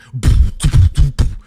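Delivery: loud mouthed beat; imitated blasting music. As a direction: boom out club music with your mouth. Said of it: "it's blasting fucking music".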